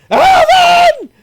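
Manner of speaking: very high, loud scream of a name; Anna shouting. Acted. Frightened Anna calls out for Alvin, shrieking. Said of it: "And she's like".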